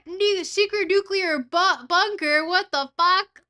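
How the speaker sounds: loud, high-pitched disbelief; shocked outburst. Real, not acted. Streamer reacting to a secret nuclear bunker, shocked outburst. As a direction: blurt it out in loud astonishment. Startled by a game location.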